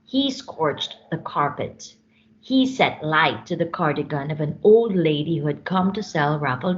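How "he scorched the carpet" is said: ordinary delivery, nothing marked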